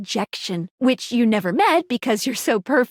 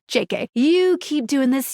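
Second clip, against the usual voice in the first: normal talking voice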